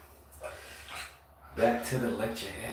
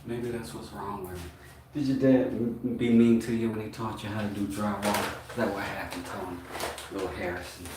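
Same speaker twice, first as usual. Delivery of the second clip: normal talking voice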